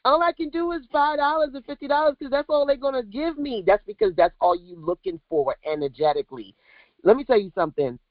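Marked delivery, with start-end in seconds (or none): 0.0-3.6 higher, whiny mimic of an underpricing client; mock their excuses in a pleading, put-on voice. Mocking scared coaches who undercharge, whiny imitation. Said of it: "you keep talking about".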